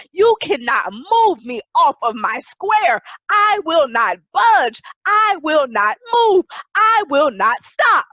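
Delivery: slow, loud, insistent preaching; resolute shouted declaration. Real, not acted. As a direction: hammer out each refusal, rising in force. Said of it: "which means being emphatic or resolute".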